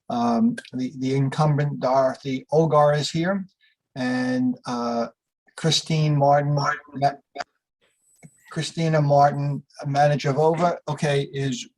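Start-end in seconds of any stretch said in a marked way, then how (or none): none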